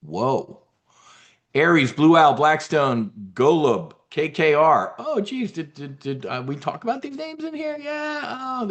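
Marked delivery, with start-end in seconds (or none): 5.0-8.7 high-pitched, delighted surprise; a pleased squeak of surprise; surprised. Real, not acted. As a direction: yelp it up high, caught off guard. Surprised at his own call.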